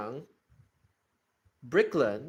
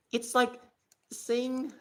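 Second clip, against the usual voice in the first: ordinary talk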